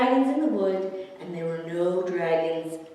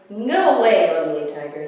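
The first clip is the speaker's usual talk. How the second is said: louder, annoyed huff; 2 semitones lower, 7 dB louder than usual. A narrator's reading. Theodore shoos the ugly tiger, mild huff. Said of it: "he huffed".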